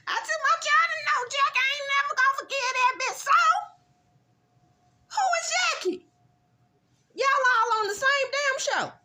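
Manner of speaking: high, loud, furious vent; Evan's angry confessional. Retold or imitated. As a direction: rant like you'll never forgive her. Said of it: "Doctor Evan gets a confessional".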